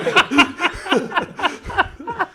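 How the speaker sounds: high, uncontrolled giggling; real high-pitched laughing fit; helpless laughter. Real, not acted. Mates laughing at his admission, helpless giggles. Laughing at someone not listening.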